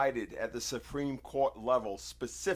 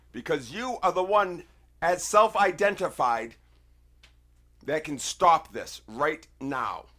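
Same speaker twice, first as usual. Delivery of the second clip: loud, higher, heated ultimatum; real angry warning; 5 semitones higher, 10 dB louder than usual. Real, not acted. Warning Keith he is personally responsible, loud and angry. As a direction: lay down the law, furious and loud. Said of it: "I sound like a madman".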